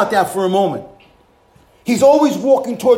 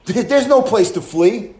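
usual speaking voice

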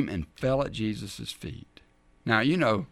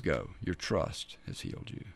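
softer, whispered blessing; gentle whisper; 6 semitones lower, 8 dB quieter than usual. Acted. Jesus blessing the healed woman, whispered and gentle. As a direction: breathe it out tenderly.

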